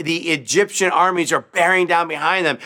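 normal talking voice

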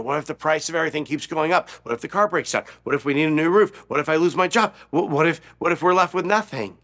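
rapid worried questions, rising in pitch; mildly panicked, racing inner voice; runaway anxious thoughts. Acted. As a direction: let the worries pile up fast. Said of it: "just runaway negative thoughts".

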